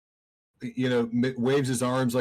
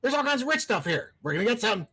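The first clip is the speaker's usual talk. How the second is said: high squeaky creature voice; 11 semitones higher, faster than usual. Acted. GM voicing monster, high pitched.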